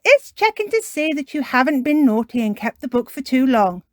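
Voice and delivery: high, loud explaining voice; Miss Rabbit. Acted. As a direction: explain it brightly and forcefully to a child. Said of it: "It's Miss Rabbit".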